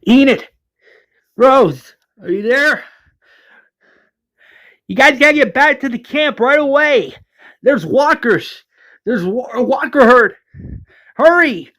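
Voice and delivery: high-pitched, panicked radio call; acted alarm, voice pushed up high; urgent warning. Valeria radios Enid and Rose about walkers, urgent alarm.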